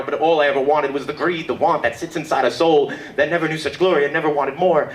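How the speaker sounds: starts at talking pitch, climbs to a high, intense rush; building poetry recital. Acted. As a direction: drive the rhythmic list upward like slam poetry. Recites own poem on stage.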